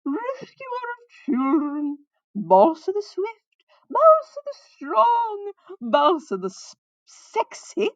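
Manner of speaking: high, loud, hugely drawn-out praise; minstrel's proclamation. A narrator's reading. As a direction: keep hailing the hero grandly over all objections. Minstrel keeps proclaiming over Balsa.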